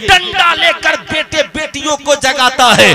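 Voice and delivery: high, loud sermon cries to the crowd; fiery preaching. Acted. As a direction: rouse the congregation, shouting. Preaching at full force.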